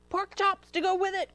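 high, eager fox cry. A narrator's reading. Fox grabs for baby Hamlet, crying out. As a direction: cry it out as you pounce. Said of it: "cried the fox".

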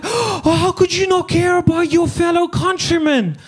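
high-pitched mock horror; hammed-up appalled gasp of a reproach; mock outrage. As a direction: imitate someone scandalised by your answer. Mimics people appalled at his answer.

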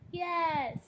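excited yes. Real, not acted. Eager answer to quiz question.

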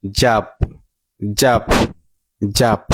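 "জাপ" with an open ah vowel; American pronunciation. Shown as a demonstration. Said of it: "American উচ্চারণ হচ্ছে".

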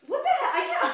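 high squeaky disbelief; surprised. Real, not acted. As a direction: yelp it in happy shock. Disbelief at honors program news.

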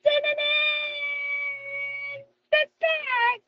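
high, squealing celebration; excited praise. Real, not acted. Teacher celebrates Mary's success, high and excited.

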